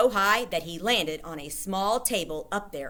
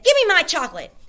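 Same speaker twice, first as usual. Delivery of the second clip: put-on demanding boy; 12 semitones higher, 10 dB louder, faster than usual. A narrator's reading. Bruno demands chocolate.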